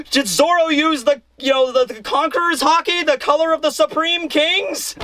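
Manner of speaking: high-pitched mock amazement; astonished. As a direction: ask it in awed, hammed-up disbelief. Acts Brook's astonished question.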